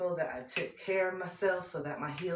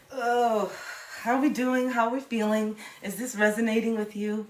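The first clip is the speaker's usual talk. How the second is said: louder, higher call to viewers; bright check-in; 6 semitones higher, 7 dB louder than usual. Real, not acted. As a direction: perk up and check on the audience. Greets viewers loudly.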